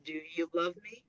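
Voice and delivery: muffled underwater audio; bad headset mic sound. Real, not acted. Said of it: "you sound like you're underwater".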